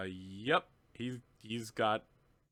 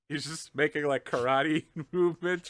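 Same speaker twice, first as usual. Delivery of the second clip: ordinary talk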